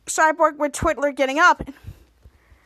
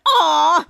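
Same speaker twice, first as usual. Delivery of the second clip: pained yelp; 7 dB louder than usual. Acted. Chess Monster kicked.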